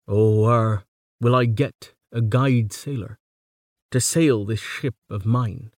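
put-on slow, measured reading of an old ballad; ballad recitation. Lecturer reciting ballad verse to students, slow and measured. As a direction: recite it like an oral ballad, stretching the lines. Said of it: "ballad meter".

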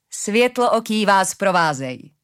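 a hint of an old woman bidding farewell; lightly voiced old woman. A narrator's reading. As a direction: send them off with a drawn-out elderly blessing.

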